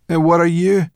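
high, loud demand; brownie girl. Acted. Brownie girl demanding the boy explain himself. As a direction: challenge him to explain himself.

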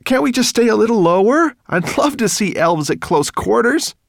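put-on high, loud boyish plea; eager pleading. A narrator's reading. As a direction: beg excitedly for a closer look. Ben pleads to stay lower.

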